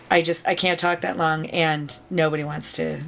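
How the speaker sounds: normal talking voice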